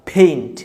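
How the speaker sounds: "ফেইন্ট" with a puff of air on the p; aspirated P. Shown as a demonstration. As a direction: drill "ফেইন্ট" with breathy p and falling tone. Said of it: "ফয়ের মতো উচ্চারণ হবে". ("ফেইন্ট" ফয়ের মতো)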